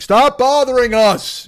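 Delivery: high, slow, loud mock shout; mock annoyed yell. Recipients rebuffing his emails, mock exasperated yell. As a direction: drag out an exasperated yell, hammed up.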